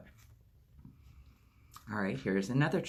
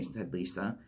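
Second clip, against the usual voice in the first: plain reading voice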